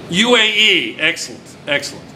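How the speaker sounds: usual speaking voice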